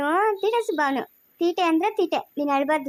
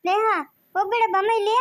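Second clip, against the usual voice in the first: put-on urgent higher-pitched call to a child; 5 semitones higher than usual. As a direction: call the child back, voice lifted. Calls back child who is leaving. (ಮಗುವನ್ನು ಕೂಗಿ ಕರೆಯುವ ಧ್ವನಿ)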